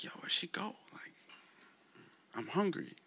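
whispered worried question; whispered, lost and hungry. Acted. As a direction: hush it like waking alone. Moetsi wakes, partner gone.

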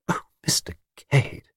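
soft, whispered wonder; awed. A narrator's reading. Miss Taylor overcome by his adventures, awed. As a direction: breathe it in hushed admiration.

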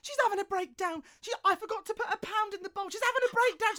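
high, loud, frantic report; retold alarm, pitched right up. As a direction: blurt it out in a high loud panic. Friend relays landlady's collapse.

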